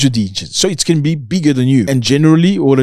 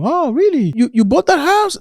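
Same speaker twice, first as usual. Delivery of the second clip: high, mock-astonished squeal; fake surprise. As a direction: play dumb, overdone disbelief. Mocking a feigned-ignorant friend.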